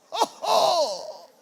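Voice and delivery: surprised exclamation. Retold or imitated. Sees woman with children.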